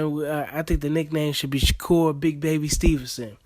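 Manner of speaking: ordinary talk, nothing marked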